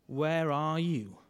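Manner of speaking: drawn out slowly, searching; slow searching question. Shown as a demonstration. Preacher asking the congregation slowly, searching. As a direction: stretch it slow, let it sink in. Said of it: "maybe you should ask it of yourself".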